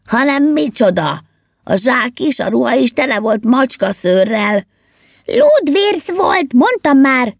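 high, heated elf argument; insist, pitch climbing as you go; insistent. A narrator's reading. Elf arguing over what was in the sack, insistent and high. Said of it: "erősködött a manó".

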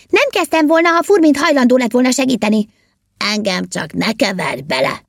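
high-pitched affronted elf; indignant protest. A narrator's reading. Elves blaming each other for the throwing, indignant protest. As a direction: protest high, then come down. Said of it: "kérte ki magának".

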